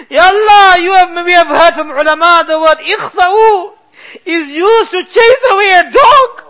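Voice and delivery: normal talking voice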